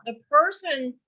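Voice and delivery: ordinary talk, nothing marked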